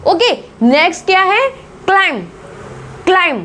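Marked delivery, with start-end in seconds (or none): 1.9-3.4 क्लाइंब as "klime", ending on m; silent b demo. Shown as a demonstration. Teacher introducing climb, silent b. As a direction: leave the b out of क्लाइंब. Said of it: "हम बोलेंगे इसको क्लाइंब".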